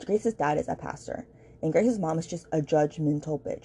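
ordinary talk

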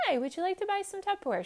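very high, quick saleswoman pitch; oblivious Tupperware lady. Acted. Saleswoman repeating her pitch as if never said, high and quick. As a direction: pitch it afresh, high and fast. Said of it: "She says it all over again as if she never said it".

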